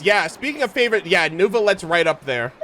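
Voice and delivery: ordinary delivery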